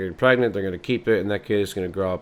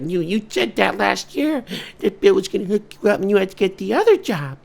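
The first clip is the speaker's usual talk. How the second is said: nagging wife; 12 semitones higher, 4 dB louder than usual. Acted. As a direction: throw his promise back at him in a high voice. Wife scolding the husband.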